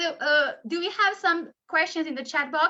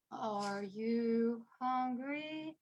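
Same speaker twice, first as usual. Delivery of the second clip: very slow, drawn-out, softer; 6 semitones lower, 11 dB quieter, much slower than usual. Shown as a demonstration. Teacher shows slowing down the song's tempo. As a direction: stretch every word out slowly. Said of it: "So slow down".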